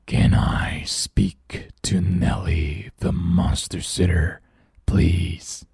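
slow, breathy whispered monster growl; deep scary monster voice. Acted. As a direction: creep it out, slow and hushed like a monster. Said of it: "growls the deep, scary voice".

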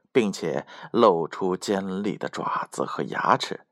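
ordinary delivery

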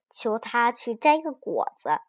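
ordinary delivery, nothing marked

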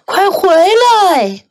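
high, long-drawn call. A narrator's reading. Old man calls the runaway back, slow high cry. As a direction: stretch the call out at high pitch. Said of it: "小老人也叫道".

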